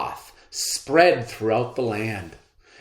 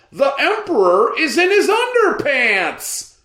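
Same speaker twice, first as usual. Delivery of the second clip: jeering shout; 7 dB louder than usual. Acted. Crowd member jeers.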